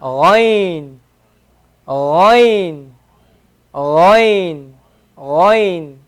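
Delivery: ghain said with a gargled throat sound; Arabic ghain. Shown as a demonstration. Teacher repeats ghain for students, a gargled throat sound. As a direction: repeat ghain slowly, letting the throat scrape.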